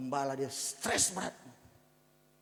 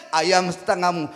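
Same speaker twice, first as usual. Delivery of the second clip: reminding voice; 3 semitones higher, 14 dB louder than usual. Retold or imitated.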